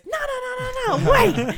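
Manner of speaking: high, loud urgent cry; imitating Dad's frantic interruption; panicked dad. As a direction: halt everyone with a sudden high yell. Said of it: "Dad goes".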